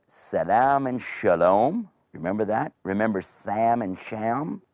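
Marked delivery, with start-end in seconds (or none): 0.1-1.9 "salam" with s, "shalom" with sh; slow, "salam" hissed on s, "shalom" on sh; s versus sh. Shown as a demonstration. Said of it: "an S sound and a sh sound". 3.2-4.6 "Sam" with s, "Sham" with sh; s versus sh. Shown as a demonstration. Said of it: "an S sound and a sh sound".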